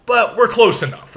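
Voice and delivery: ordinary talk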